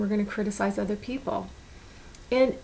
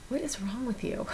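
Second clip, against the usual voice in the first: 1 quiet self-scolding; 2 semitones higher, 7 dB quieter than usual. Retold or imitated. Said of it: "I go".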